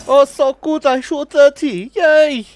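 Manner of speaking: excited cheering. Real, not acted. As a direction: cheer your own score at the top of your voice. Celebrating thirty kills.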